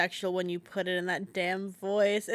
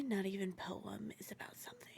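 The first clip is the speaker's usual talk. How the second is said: whispering; 14 dB quieter, faster than usual. Acted. Reading poem line aside.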